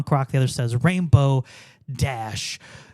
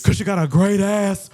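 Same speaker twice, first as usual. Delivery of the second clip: louder gruff wrestler delivery; wrestler voice mix; 2 semitones higher, 4 dB louder than usual. Acted. Co-host repeats the line in a louder mixed wrestler voice. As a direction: growl it like a wrestling promo. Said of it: "just a mix of voices".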